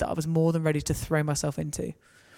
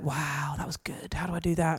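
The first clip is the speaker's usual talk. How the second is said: soft, breathy amazement; half whisper it in wonder; student awed after hearing a prayer; awed hush; 7 dB quieter than usual. Retold or imitated. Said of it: "this guy looked at me and went".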